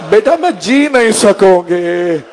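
slow, drawn-out mournful plea; grieving mother. Retold or imitated. Mother pleading with her son, mournful lament. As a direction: lament it, stretching the words out. Mother's grief voiced in majlis.

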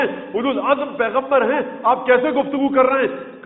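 rising majlis oratory, voice lifted high; full-force preaching. Acted. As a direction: preach it out to the crowd.

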